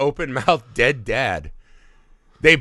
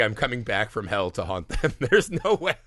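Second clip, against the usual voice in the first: ordinary delivery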